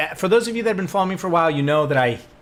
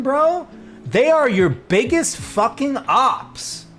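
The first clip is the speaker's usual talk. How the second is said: high, loud, drawn-out outrage; exasperated rant; 12 semitones higher, 5 dB louder than usual. Real, not acted. Venting to viewers about British critics, high exasperated rant. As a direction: rant with the pitch way up.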